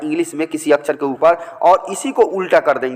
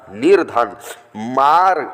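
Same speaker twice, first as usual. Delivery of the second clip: ordinary talk, nothing marked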